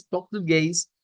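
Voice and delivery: "portugués" with throaty r and -eis glide; Brazilian Portuguese pronunciation. Shown as a demonstration. Teacher explaining it is Portuguese, Brazilian pronunciation. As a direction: pronounce "portugués" as a Brazilian would. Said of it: "pero eso no es español".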